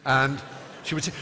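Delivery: usual speaking voice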